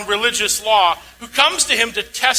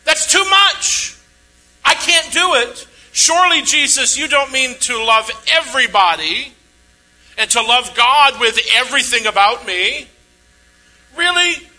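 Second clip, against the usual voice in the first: preacher voicing the religious leader's disbelief; incredulous protest; 3 semitones higher, 4 dB louder than usual. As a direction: play the lawyer balking, "surely you don't mean that". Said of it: "what's the religious leader saying?".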